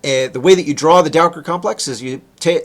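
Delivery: ordinary delivery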